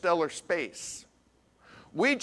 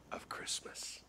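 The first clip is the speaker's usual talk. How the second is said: breathy hushed whisper; whispered with a smile; 20 dB quieter than usual. Acted. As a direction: lean in and whisper it like a secret. Preacher drops to hushed aside.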